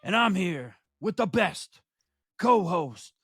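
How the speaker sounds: slow, punched ring-announcer intro with big pauses; show-opening announcer. Acted. Host introduces the show and tag team partner, announcer style. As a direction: announce each name like a main event.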